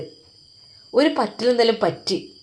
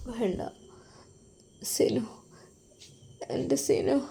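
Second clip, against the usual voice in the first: barely-there whisper from a hospital bed; faint, exhausted whisper of a patient waking up; weak faint whisper; 11 dB quieter, slower than usual. Acted. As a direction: breathe the words out, too weak to voice them.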